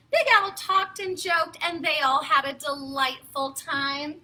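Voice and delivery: usual speaking voice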